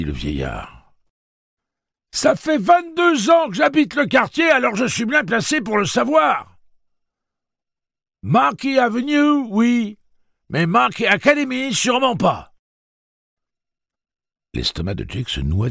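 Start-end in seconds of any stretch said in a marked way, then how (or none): 2.1-12.5 put-on very high, loud cracked old man; old man's voice. Old man correcting Jake, high loud old voice. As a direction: play a cranky old man.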